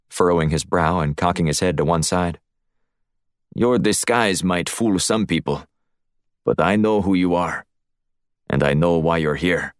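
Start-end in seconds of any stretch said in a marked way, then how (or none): 3.5-9.7 put-on deliberate Russian-accented challenge; menacing Russian accent. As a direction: confront him as a knowing Russian heavy. Voices the Russian host.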